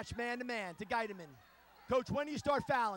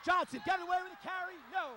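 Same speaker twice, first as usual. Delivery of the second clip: excited call; 6 semitones higher, faster than usual. Real, not acted.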